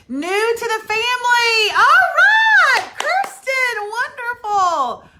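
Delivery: high, loud, thrilled welcome; excited welcome. Real, not acted. Welcoming a new family member, shouting with delight. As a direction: greet the newcomer with a squeal.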